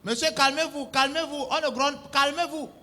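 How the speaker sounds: ordinary talk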